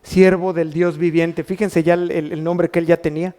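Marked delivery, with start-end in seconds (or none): none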